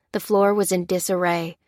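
ordinary delivery, nothing marked

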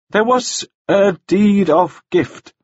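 slow, high, wretched admission; miserable. A narrator's reading. Nielsen giving up the secret to the jailer, slow and miserable. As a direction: confess it unwillingly, in misery. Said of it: "Nielsen said miserably".

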